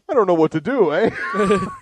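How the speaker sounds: hammed-up Canadian accent with "hoose" for house; bad Canadian accent. Drunk son kicked out by Mom, in a bad Canadian accent. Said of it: "that was my bad Smodcast Canadian accent".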